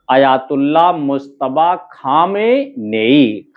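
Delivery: slow, measured Ayatollah मुस्तबा Khamenei, held syllables; slow dictation. Shown as a demonstration. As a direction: stretch out each part of Khamenei. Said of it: "क्या हो गया?".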